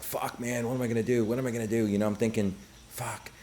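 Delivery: quietly freaking out under his breath; panicked inner thoughts. Retold or imitated. Alone while she's in the bathroom, a hushed panic.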